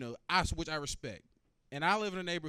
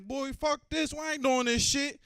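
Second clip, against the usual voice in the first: high loud panicked protest, drawn out slowly; 9 semitones higher, 7 dB louder, slower than usual. Retold or imitated. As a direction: balk at the open door in terror. Panicking at the plane door.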